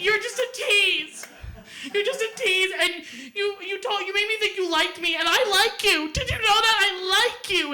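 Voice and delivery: put-on a teen soap-opera fight, shouting at him; screaming fight. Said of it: "screaming at each other".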